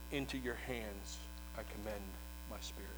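barely audible, breathy final words; dying last words. Acted. Preacher voicing dying Jesus on the cross, faint and spent. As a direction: let it fade out like a last breath.

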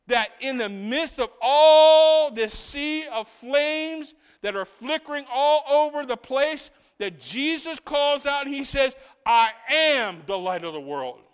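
loud, slow, soaring preaching; full-force preaching. Acted. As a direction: lift it over a sea of flames.

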